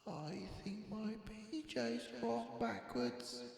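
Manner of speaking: high, soft little voice; small complaining Boris. A narrator's reading. Sleepless cub calling to parents, high and soft. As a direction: fret quietly from bed.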